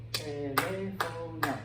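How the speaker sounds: slow, drawn-out te-lé-fo-no in teléfono; syllable by syllable. Shown as a demonstration. Teacher counts syllables for the class, teléfono slowly broken up. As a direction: stretch each of the four syllables of teléfono. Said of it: "No, four syllables".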